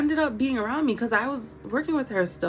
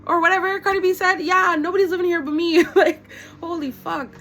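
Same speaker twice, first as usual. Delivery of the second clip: excited outburst; 9 semitones higher, 7 dB louder than usual. Real, not acted. Laughing outburst over [name].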